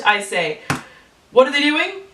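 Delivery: ordinary delivery, nothing marked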